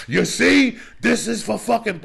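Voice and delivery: high, loud mocking of a wasted guy; hammed-up junkie boast; loud mock bragging. Imitating high guy mid-brag.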